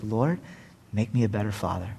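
soft, low, broken-hearted plea; a father asking God to change him; humble prayer. Retold or imitated. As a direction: pray it quietly alone. Retold private prayer.

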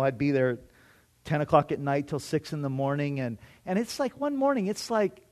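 usual speaking voice

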